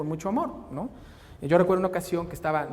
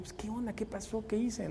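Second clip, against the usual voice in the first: much softer, puzzled self-talk; quiet puzzled inner voice; puzzled murmur; 3 semitones higher, 7 dB quieter than usual. Retold or imitated. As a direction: mutter it to yourself, confused. Father puzzled, talking to himself.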